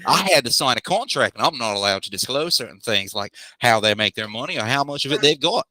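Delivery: put-on a clear Australian accent back on for the clerk; Aussie accent. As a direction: Helmet the clerk explains the contract in Aussie. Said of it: "that Aussie accent".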